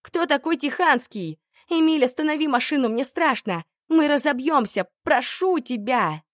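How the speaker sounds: high, loud terrified pleading; screaming in terror. A narrator's reading. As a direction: scream and beg in panic. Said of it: "Она уже откровенно кричала".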